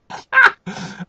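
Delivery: squeaky burst of giggling; high giggle. Real, not acted. Reader giggling at the piggy girl, high.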